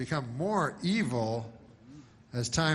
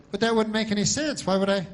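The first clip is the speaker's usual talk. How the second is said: dog's reply; 9 semitones higher, 6 dB louder than usual. Acted. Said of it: "And then the dog goes".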